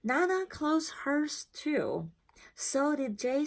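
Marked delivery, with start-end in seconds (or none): none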